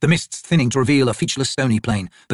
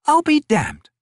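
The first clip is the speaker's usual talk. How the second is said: surprised curse; 3 dB louder, much slower than usual. Acted. Picker voiced higher.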